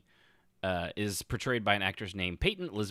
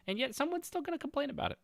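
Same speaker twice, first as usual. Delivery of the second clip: exasperated; 4 dB quieter, much faster than usual. Real, not acted. Exasperated rant peak.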